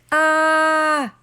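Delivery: high loud shriek of panic; panicked scream. A narrator's reading. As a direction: let out a terrified scream. CJ screams again.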